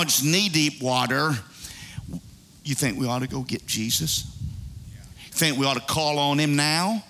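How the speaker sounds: low, underplayed reluctant suggestion; understated wry hesitation. People in a sinking boat finally considering Jesus, low and understated. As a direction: drop low, as if asking sheepishly. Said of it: "You know, like we do".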